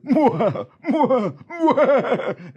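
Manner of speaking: high loud mwah cackle; laughing bandit. Acted. Laughing Bob cackles high and loud before running. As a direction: cackle like a madman. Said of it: "he got up and said".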